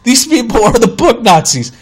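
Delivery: exasperated rant. Retold or imitated. Customer ranting about bookstore staff, mock outrage. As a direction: hammer it out in comic outrage.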